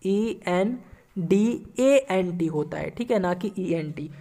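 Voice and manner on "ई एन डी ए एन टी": slow letter-by-letter dictation; spelling out slowly. Shown as a demonstration. Teacher correcting a spelling for the class, slowly. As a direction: call each letter clearly and slowly. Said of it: "ये कहाँ गलत था?".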